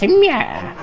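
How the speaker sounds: high cat meow. Acted. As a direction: mew like a cat. Imitating cat.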